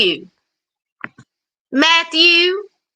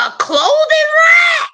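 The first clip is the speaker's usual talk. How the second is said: exasperated shout; 11 semitones higher than usual. Real, not acted. Scolding Matthew over Greta's missing space, exasperated shout. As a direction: yell the last words at him in disbelief.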